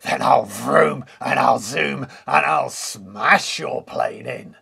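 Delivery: a boastful wolf threat; cried. Acted. Wolf threatening Woody's plane, loud boastful cry. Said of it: "cried the wolf".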